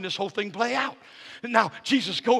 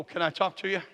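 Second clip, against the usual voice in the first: ordinary talk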